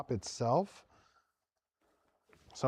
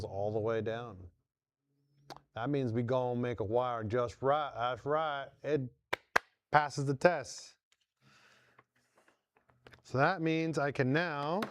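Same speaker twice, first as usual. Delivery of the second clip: put-on Southern accent; 3 semitones lower, 3 dB quieter than usual. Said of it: "like, a Southern accent".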